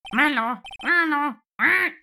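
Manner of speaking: high squawking parrot chatter. Acted. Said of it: "my parrot voice".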